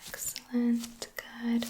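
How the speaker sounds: ordinary delivery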